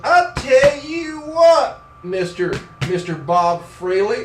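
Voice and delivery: loud, high, stretched-out country catchphrase, then steadier; hammed-up country drawl; brother's country drawl. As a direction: mimic a drawling brother's greeting. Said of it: "Every other sentence begins with".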